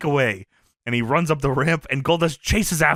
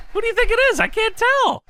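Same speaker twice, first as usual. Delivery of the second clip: high, put-on commentator voice; Jerry Lawler impression. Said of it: "Lawler says".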